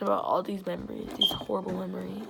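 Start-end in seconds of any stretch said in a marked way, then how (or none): none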